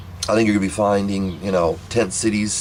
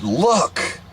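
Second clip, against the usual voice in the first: urgent insistence; 8 semitones higher than usual. Retold or imitated. Child urging mom to look.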